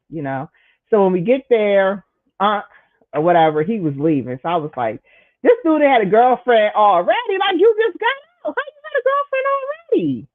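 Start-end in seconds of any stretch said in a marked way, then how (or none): 5.4-10.3 high squealing disbelief; shrill-free high mocking question; incredulous mock. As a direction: go way up in pitch, scandalized.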